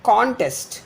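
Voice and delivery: Contest with a high, strong CON; CONtest, open stressed first syllable. Shown as a demonstration. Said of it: "Con is stressed".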